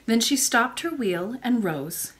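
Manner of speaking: usual speaking voice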